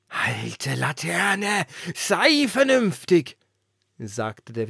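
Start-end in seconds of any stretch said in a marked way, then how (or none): 0.1-3.4 slow, much higher and louder rebuke; scolding wind. A narrator's reading. The wind scolding the old lantern, booming. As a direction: call her to reason, stretched out.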